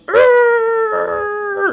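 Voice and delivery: high, loud anguished monster roar; imitated monster cry. Recalling the monster's cry in the film, a high anguished roar. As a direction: cry out like the tragic Frankenstein monster. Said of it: "The way he cries and goes".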